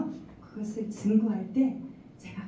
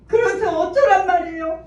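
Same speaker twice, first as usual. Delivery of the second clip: louder and higher, protesting; exasperated protest; 10 semitones higher, 10 dB louder than usual. Retold or imitated. Mother protesting to God, loud and exasperated. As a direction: push back in frustration.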